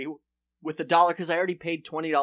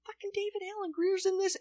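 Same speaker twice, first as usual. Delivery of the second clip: high, thrilled disbelief; surprised; 6 dB quieter than usual. Retold or imitated. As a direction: squeal in delighted disbelief. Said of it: "and you're like".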